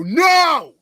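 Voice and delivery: high, loud blurted No; one big high-pitched shout; retold yell. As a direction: yell it out in sudden alarm. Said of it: "I fucking yell".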